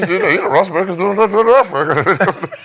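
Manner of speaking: high, loud, laughing mumble; mock a coach talking through a numb, half-open mouth; mumbling coach impression. Clowning for co-hosts about a dubbed-sounding coach, mumbling mock voice. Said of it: "can't open half of his mouth".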